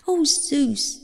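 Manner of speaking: high, exasperated protest; interrupting. A narrator's reading. Demeter cuts Zeus short, high protesting interruption. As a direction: break in with a high-pitched appeal. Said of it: "Demeter interrupted him".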